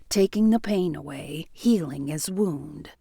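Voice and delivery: usual speaking voice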